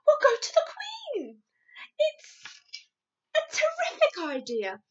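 high, loud, thrilled announcement; excited cry. A narrator's reading. Sophie announcing her plan, thrilled cry. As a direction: burst out eagerly, pitched right up. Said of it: "cried Sophie".

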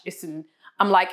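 ordinary talk

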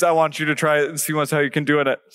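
usual speaking voice

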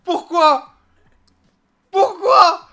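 high wailing shout; anguished shouting. Pretend. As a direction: howl it in mock despair. Shouting at mocking partner.

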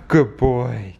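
ordinary delivery

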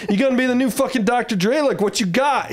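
high, cocky trash talk. Retold or imitated. Mocking the rapper with a high cocky challenge. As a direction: show off and throw it back at him. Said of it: "really talking shit back to him".

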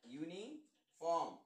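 Uniform drawn out slowly, with a break after युनि; slow pronunciation demo. Shown as a demonstration. Teacher shows pupils the y sound, slowly splitting uniform. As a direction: say uniform slowly in two parts, युनि, then form. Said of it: "य उच्चार असणार आहे".

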